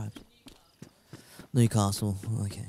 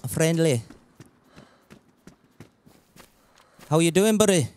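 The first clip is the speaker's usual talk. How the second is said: friendly put-on Scottish; amateur Scottish accent to a stranger; Scottish accent attempt. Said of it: "I tried to do a Scottish accent".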